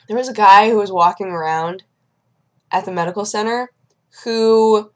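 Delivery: ordinary talk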